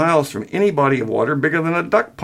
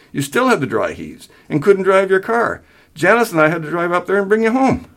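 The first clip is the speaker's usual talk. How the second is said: higher-pitched, insistent reminder; holding back a snicker; 7 semitones higher than usual. A narrator's reading. Son lists father's failings, suppressing a snicker. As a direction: press the case while keeping amusement down. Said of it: "willed the snicker out of his tone".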